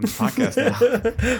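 laughing it out, high and loud. Real, not acted. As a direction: burst into laughter mid-sentence. Jokes about cutting the mistake.

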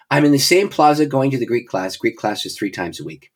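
usual speaking voice

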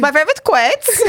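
a hint of Borat; accidental Borat-ish lilt; Borat accent. Friend repeating quotes in a slight Borat accent. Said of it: "I don't know why I sounded like, what's his name? Borat".

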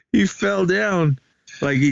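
ordinary talk

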